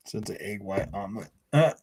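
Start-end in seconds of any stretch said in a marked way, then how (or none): none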